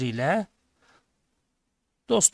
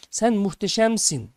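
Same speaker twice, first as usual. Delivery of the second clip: slow, higher, raised call of praise; narrated shout; 5 semitones higher, 4 dB louder than usual. A narrator's reading. Father shouts again to the mountain, narrated shout. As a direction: call it out long and high. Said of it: "Baba tekrar bağırıyor".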